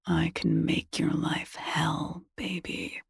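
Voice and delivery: soft threatening murmur; slow, whispered menace. Acted. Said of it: "he murmurs".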